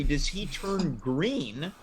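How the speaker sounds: normal talking voice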